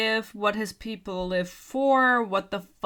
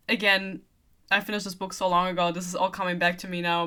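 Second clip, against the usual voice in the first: ordinary talk, nothing marked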